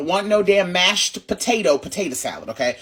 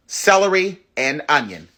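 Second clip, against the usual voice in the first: ordinary delivery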